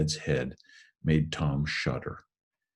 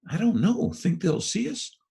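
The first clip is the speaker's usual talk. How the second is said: frightened; 14 semitones higher than usual. Acted. Tom scared in the graveyard.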